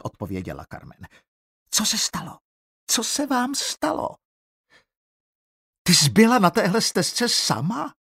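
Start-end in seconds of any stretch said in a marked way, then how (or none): 1.7-4.1 put-on frightened girl. Frightened girl at sight of blood. 5.8-7.9 high, loud alarmed cry; alarmed shout. Acted. Teacher cries out at the girl in alarm, loud high. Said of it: "vykřikla Eliza".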